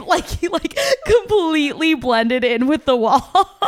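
real laughter breaking into speech; laughing. Real, not acted. Cracking up over the green screen joke.

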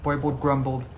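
ordinary delivery, nothing marked

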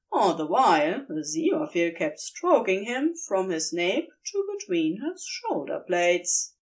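usual speaking voice

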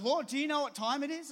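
a mock-startled question to the Lord; incredulous protest. Protesting to God in retold thought.

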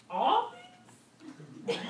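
mock disbelief, pitch jumping up; incredulous. To the congregation, a mock shocked question. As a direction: feign shock at the demand.

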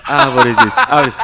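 speech therapist voice. Retold or imitated. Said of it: "uma fonoaudióloga falando".

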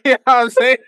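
high, loud laugh through the line. Real, not acted. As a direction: crack up mid-question. Laughing at his own story.

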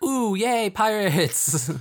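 put-on high, loud little-girl cheer; excited little girls. Little girls taken hostage by pirates, gleeful high cheer. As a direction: squeal it like delighted kids. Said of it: "they're all like".

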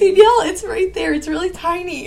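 high, urgent plea, loud at first; spider alarm. Real, not acted. Pleading for help with a spider.

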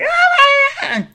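high loud scream; startled scream. Retold or imitated. Driver nearly hit in traffic, high scream. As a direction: scream as the car nearly hits. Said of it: "I was like".

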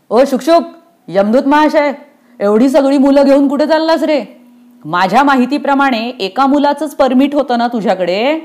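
nosy, high-voiced guard; officious doorkeeper. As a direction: hail him, then question him pointedly. Doorkeeper hails and challenges messenger.

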